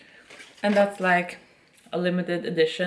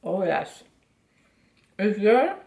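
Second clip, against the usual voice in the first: talking through a mouthful of cookie; words muffled round a bite; mouth full; 3 semitones higher, 4 dB louder than usual. Real, not acted. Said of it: "I'm talking with my mouth full".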